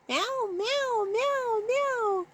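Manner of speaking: high soft mock kitten meows; kitten's meow. Owner imitating Doopy the kitten's meow. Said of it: "Doopy's voice".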